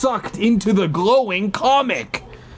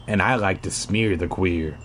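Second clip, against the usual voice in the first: much lower, quieter mock aside; low joke voice; 10 semitones lower, 4 dB quieter than usual. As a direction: drop into a goofy deep voice.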